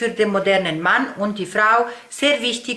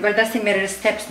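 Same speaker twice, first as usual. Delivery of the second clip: normal talking voice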